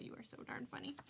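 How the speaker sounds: whisper. Real, not acted. Aside to a viewer in chat.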